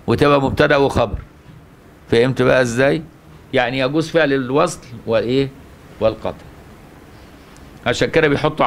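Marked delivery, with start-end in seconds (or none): none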